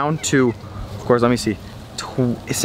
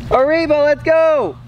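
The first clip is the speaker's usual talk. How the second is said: excited shout; 10 semitones higher, slower than usual. Real, not acted. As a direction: cheer her on at full voice. Urging daughter to run.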